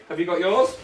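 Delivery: normal talking voice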